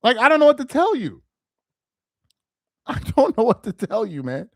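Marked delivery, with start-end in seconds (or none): none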